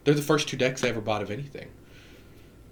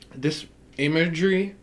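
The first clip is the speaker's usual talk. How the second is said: "This imagery" with a Southern twang; southern accent; 7 semitones higher, 4 dB louder than usual. Real, not acted. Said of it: "my southern accent really came out there".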